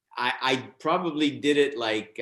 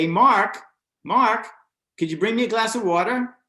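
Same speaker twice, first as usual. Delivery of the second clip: raised voice, calling toward the wings; calling offstage; 9 semitones higher, 5 dB louder than usual. Retold or imitated. Boss calling his assistant from the stage, loud call.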